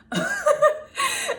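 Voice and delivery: squealing high laugh; laughing at her own comeback; high burst of laughter. Real, not acted. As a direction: break into a high, loud laugh.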